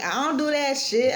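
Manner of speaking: heated retold refusal, pitched high; going off on him. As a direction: push the drink away, indignant. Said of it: "Yeah, I went off on him".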